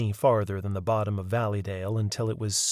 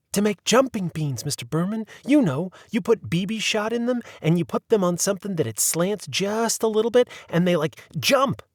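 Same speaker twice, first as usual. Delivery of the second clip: put-on high, eager kid's voice explaining; a young boy bubbling over with his idea; lightly higher boyish excitement; 9 semitones higher than usual. A narrator's reading.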